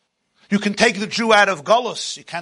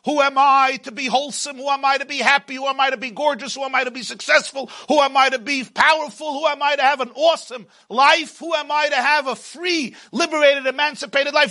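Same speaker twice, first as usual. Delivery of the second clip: higher, sneering self-doubt; Pharaoh's inner voice; 5 semitones higher than usual. Acted. Inner Pharaoh taunting the listener, higher sneering voice. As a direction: let the inner Pharaoh mock you. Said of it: "That is the voice of Pare".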